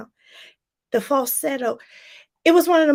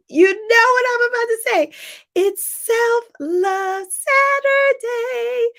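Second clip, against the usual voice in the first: excited catchphrase; 11 semitones higher, 5 dB louder, slower than usual. Shown as a demonstration.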